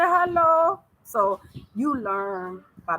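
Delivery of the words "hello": high Tanzanian "hello", rising at the end; Tanzanian accent. Retold or imitated. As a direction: greet back like a local, high and loud. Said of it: "they say".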